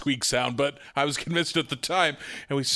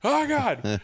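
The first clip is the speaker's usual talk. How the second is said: retold panic; 9 semitones higher than usual. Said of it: "just like".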